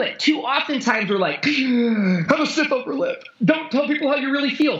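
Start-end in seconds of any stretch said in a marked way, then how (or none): 1.4-4.8 mocking stuffy advice. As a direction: lecture like a prim stiff-upper-lip type. Mocking stiff-upper-lip advice.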